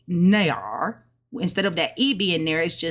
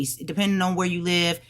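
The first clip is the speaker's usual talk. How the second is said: normal talking voice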